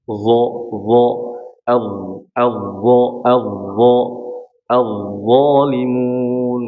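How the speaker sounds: "Dha" tongue tip to the upper teeth, sound pushed outward; slow drill on "zalimun", drawn out; pronunciation drill. Shown as a demonstration. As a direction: tajwid teacher drilling "zalimun". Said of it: "suaranya lebih keluar".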